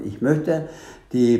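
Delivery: usual speaking voice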